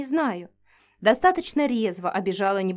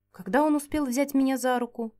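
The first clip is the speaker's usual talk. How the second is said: plain reading voice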